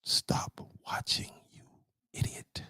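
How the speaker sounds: whispered insult. Real, not acted. Streamer whispering an insult at a viewer, soft whisper. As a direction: hiss it under your breath.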